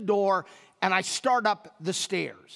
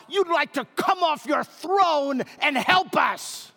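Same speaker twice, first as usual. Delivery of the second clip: high, loud, furious mock shout; angry wife; 7 semitones higher, 3 dB louder than usual. Wife yelling at traveling husband, high furious shout. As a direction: tear into him like a fed-up wife. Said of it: "my wife could have responded".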